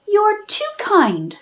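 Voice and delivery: high, slow, shy little mouse; tiny mouse voice. Acted. Mouseling replies.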